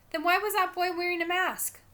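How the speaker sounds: put-on high-pitched small-child voice; puzzled little brother, voice lifted; little boy asking. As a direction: question it like a young child. Small brother asks innocent question.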